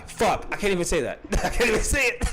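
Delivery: laughing through the words. Real, not acted. Laughing at his own lie to the man he exposed. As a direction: crack up while admitting it.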